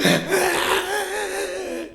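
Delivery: high mock wail; drawn-out fake sobbing like a child; imitated child's crying. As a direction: imitate a kid bawling. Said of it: "울어, 이렇게".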